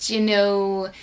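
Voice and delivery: very slow drawn-out compliment; slow mock praise; slow teasing. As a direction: tease her slowly. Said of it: "he did this with his finger".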